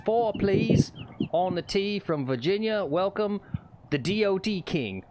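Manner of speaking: hammed-up Southern accent, first-tee announcer; Southern golf announcer. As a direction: play a Southern starter calling the golfer to the tee. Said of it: "that was my best, uh, Southern accent".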